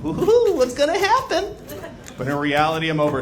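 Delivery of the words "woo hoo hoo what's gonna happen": very high squealing woo; adult mimicking teenage self, high and giddy. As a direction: play giddy seventeen. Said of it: "like, I'm like".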